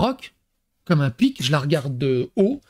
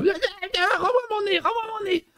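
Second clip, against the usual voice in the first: put-on much higher and softer child's plea; little daughter's voice; 14 semitones higher, 5 dB quieter than usual. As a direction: play the little girl begging for her nose back. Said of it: "Puis elle vient". (petite fille qui supplie, voix très aiguë et douce)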